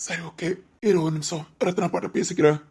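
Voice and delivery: low, soft, half-whispered; hushed aside. Acted. Friend steps aside to talk to Ratnappa, hushed. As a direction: drop to a low hush.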